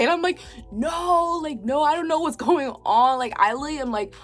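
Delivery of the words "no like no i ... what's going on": higher-pitched mock-distressed protest; retold bawling. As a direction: act out being too upset to explain. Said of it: "bawling, guys".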